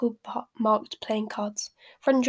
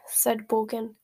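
usual speaking voice

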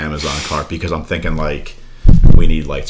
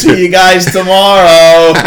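high, loud, drawn-out farewell; imitated child calling goodbye; 11 semitones higher, 6 dB louder, slower than usual. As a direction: call it out slowly, pitched way up. Imitating child calling from leaving car.

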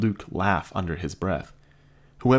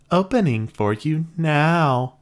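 slow, high sultry robot voice; robot trying to sound sexy; seductive robot; 9 semitones higher, 4 dB louder, slower than usual. Acted. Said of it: "moaned robotically as an added touch".

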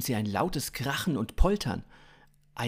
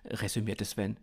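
ordinary delivery, nothing marked

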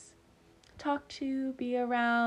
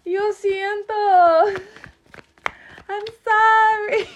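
very high, loud, drawn-out fake cry; mock wailing apology; 13 semitones higher, 11 dB louder, slower than usual. Apologizing for rubbing people wrong, mock wail. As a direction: wail it out, hammed-up and stretched.

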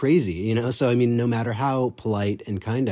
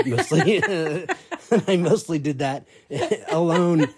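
laughing; 9 semitones higher, 3 dB louder than usual. Real, not acted. Laughing at her own story.

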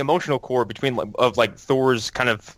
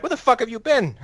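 high-pitched comic exasperation; punchline pitched way up, playfully affronted; mock indignation; 11 semitones higher than usual. The French to late-arriving America, mock indignant.

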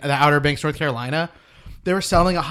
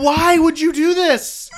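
exasperated shout; 12 semitones higher, 4 dB louder, slower than usual. Retold or imitated. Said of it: "I'm like".

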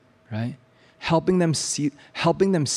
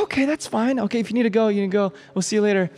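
teacher voice; 7 semitones higher than usual. Retold or imitated.

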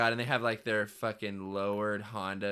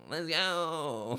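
higher, drawn-out "let's go"; hyped-up cheer; 7 semitones higher, 3 dB louder than usual. Acted. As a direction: cheer like a pumped-up teenage boy. Hyped teen boys bit.

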